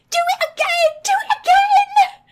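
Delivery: high, loud goblin shriek with a laugh; excited shriek. A narrator's reading. Goblin child shrieking at the caged bear. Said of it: "one of them shrieked in excitement".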